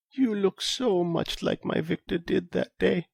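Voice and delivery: put-on high, soft, slow old lady; wistful elderly woman remembering. Elsa's tearful memory.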